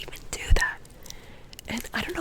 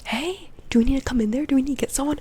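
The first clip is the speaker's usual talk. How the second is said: whispered quote; 6 dB louder than usual. Retold or imitated. Retold question from outside stall.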